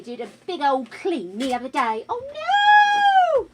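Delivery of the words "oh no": high, squealing oh no; surprised. Real, not acted. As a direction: yelp as the cake falls. Cake falling into dog's bed.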